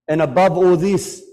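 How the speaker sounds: loud, rising wail of grief; building lament. Real, not acted. Preacher to congregation, loud mourning cry. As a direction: lift the voice in grief.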